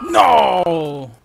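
loud dismayed no; frustrated shout. Real, not acted. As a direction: cry out in frustration. Frustration mid-game.

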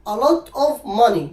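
slow, loud a lot of money; emphatic example. Shown as a demonstration. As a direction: lean hard on lot of money. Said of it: "خلي بالك".

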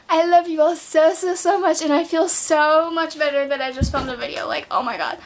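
normal talking voice

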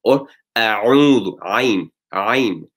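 "A'udhu" slow, with a tight ʿain from the middle of the throat; throat ain. Shown as a demonstration. Teacher demonstrating the ʿain sound, slowly. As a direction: squeeze "Ain, Ain" from mid-throat. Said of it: "from the middle part of the throat".

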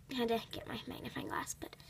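rushed whisper. Acted. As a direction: whisper it in a hurry. Hurried whispered apology.

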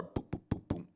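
soft voiceless knocking imitation; mimicked knock on a hotel door; knocking sound. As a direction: tap out the knock with your voice.

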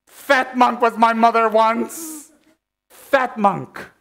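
high, slow, drawn-out proclamation; dramatic slow refrain. Acted. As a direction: stretch it out like a grand lament. Comic dramatic refrain, slowed.

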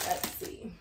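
whispered to herself; a soft whispered aside; whispering. Real, not acted. Repeats let's see, whispered aside.

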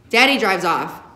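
ordinary delivery, nothing marked

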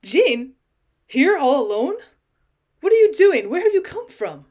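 high, loud, surprised questions; astonished. A narrator's reading. Cousin finding her all alone, astonished.

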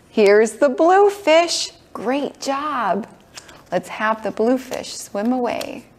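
bright, sing-song preschool teacher; kids show host. Acted. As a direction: coax little ones along gently. Leads toddlers in a kids' class.

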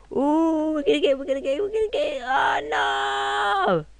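winding up then yelling oh no; excited gamer voice climbing to a panicked shout; rising panic. Real, not acted. Ragdoll crash building to scream.

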